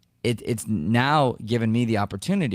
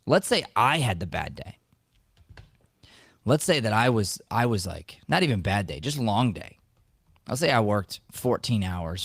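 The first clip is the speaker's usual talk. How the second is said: normal talking voice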